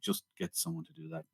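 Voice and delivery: very soft mock confidential instruction; off-camera aside. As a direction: drop right down, as if out of the actor's earshot. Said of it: "And then off camera".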